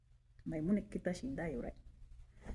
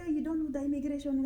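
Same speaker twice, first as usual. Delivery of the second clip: mocking officials; 7 semitones higher than usual. Imitating immigration officials talking down to her, higher and mocking. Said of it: "They say".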